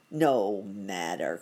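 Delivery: low, slow alligator voice; low storybook villain; crying out as alligator. A narrator's reading. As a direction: brush it aside in a low, drawn-out voice. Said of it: "cried the alligator".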